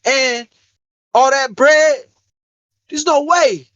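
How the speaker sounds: ranting friend. Retold or imitated. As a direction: play the buddy getting heated about God. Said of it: "He started going crazy".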